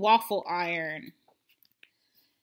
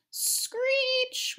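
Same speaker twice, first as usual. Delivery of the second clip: very high screech of metal; toaster screech. A narrator's reading. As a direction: imitate a scraping screech. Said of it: "went the toaster".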